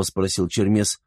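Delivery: plain reading voice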